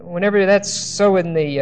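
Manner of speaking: normal talking voice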